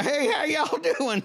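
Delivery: slow, high Southern drawl greeting; Southern accent greeting. Pretend. Comic pretending to improv onstage, hammed-up Southern accent. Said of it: "every five seconds, you have a new accent".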